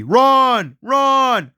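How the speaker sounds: high, urgent cry to flee; urgent yell. Acted. Explorer urging friend to flee followers, urgent yell. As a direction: call it out high, urging them on.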